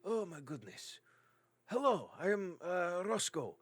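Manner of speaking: high, exclaiming character voice; gush, then greet them eagerly; delighted dwarf. Acted. Dwarf introducing himself to his rescuers, delighted greeting.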